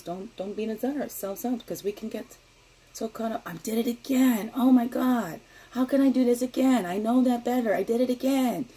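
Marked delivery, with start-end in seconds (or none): 3.4-8.7 exasperated self-scolding. Acted. Acting out self-scolding inner voice.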